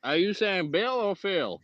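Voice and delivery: mock Texan accent. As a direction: ask it slowly like a Texan. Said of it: "we just both do Texan".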